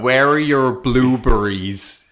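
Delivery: loud, high, drawn-out "blueberries"; "blueberries" stretched slowly as if to someone uncomprehending; exaggerated enunciation. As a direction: hammer out "blueberries" slowly and loudly. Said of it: "how can I say it differently".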